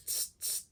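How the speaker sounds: hissed unvoiced tss like a hi-hat. Shown as a demonstration. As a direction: hiss short tss like a snake. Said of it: "by doing tss".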